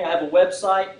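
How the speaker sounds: ordinary talk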